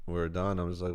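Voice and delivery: ordinary talk, nothing marked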